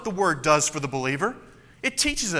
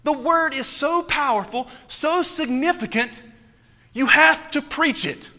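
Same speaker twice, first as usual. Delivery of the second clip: higher, pressing emphasis; impassioned preaching; 7 semitones higher, 3 dB louder than usual. Acted. Pastor urging his listeners to preach, impassioned. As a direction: lift it with urgency.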